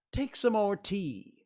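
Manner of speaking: high, slow, loud offer; very earnest. A narrator's reading. March Hare offering Alice tea, very earnest. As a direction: press it on them, drawn out and high. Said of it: "to Alice very earnestly".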